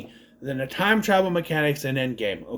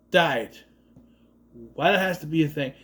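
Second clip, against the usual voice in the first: ordinary delivery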